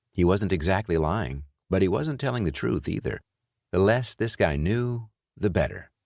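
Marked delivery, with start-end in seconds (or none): none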